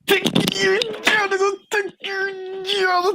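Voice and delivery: very high, drawn-out squawk; hammed-up high-pitched protest; mock outraged yokel. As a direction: squawk it in a silly strained falsetto. High mock chant of host's phrase.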